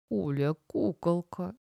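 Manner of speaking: soft, slow, drowsy mumble; drawn out softly as if dozing off; lazy, sleepy. A narrator's reading. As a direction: say it lazily, half waking up. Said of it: "лениво произнёс Эдик, широко зевнув".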